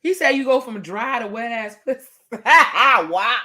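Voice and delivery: usual speaking voice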